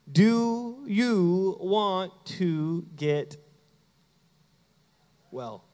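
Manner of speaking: very slow, word by word, dropping on WELL; slow, deliberate question. Acted. Preacher quoting Jesus' question to the sick man, slow and weighty. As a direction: space out each word of the question.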